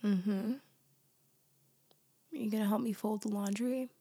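usual speaking voice

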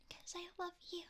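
ordinary talk, nothing marked

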